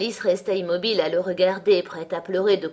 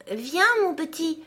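high, gentle coaxing call; 9 semitones higher than usual. Acted. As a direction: coax a shy fawn closer. Alice coaxing the fawn.

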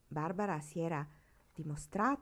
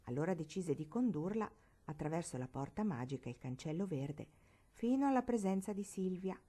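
ordinary delivery, nothing marked

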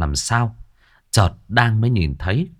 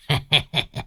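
soft, raised giggle; teasing chuckle; 5 semitones higher, 4 dB quieter than usual. A narrator's reading. Old master teasing the couple at dinner, sly chuckle. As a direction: chuckle before needling the young couple.

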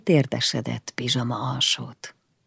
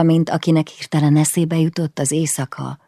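usual speaking voice

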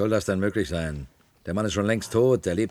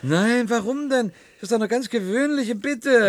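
indignant high protest; 12 semitones higher, 6 dB louder than usual. Acted. Host insisting loudly the clown perform. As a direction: push back, voice going up.

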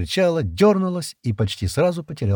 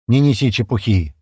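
louder, mild scolding shout; sharp scolding; 5 dB louder than usual. A narrator's reading. Стэн scolds Шира for talking nonsense, mildly shouted reprimand. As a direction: cut them off with a raised voice. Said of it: "прикрикнул на неё Стэн".

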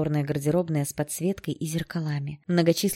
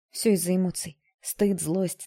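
usual speaking voice

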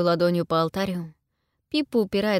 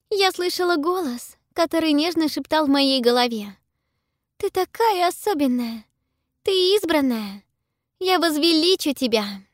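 put-on high, rapturous girl's voice; enraptured girl voice; 10 semitones higher, 5 dB louder than usual. A narrator's reading. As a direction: tell it in ecstasy. Pippa's voice.

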